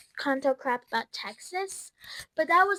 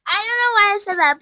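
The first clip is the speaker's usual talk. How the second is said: exasperated outburst; 7 semitones higher, 10 dB louder than usual. Real, not acted. Exasperated at herself, cry heard.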